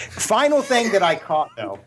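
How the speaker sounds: laughing while getting back on track. Real, not acted. Host laughing at his own slip, laughing. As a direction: laugh through the words. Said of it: "That was funny".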